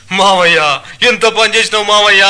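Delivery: loud, high-pitched mock lament; fake wailing. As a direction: wail for your uncle to fool the crowd. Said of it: "అని ఏడ్చుకుంటూ లోపలికి వెళ్ళాడు". (దొంగ ఏడుపు, పెద్దగా రాగం తీస్తూ)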